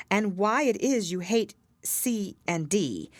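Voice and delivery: ordinary delivery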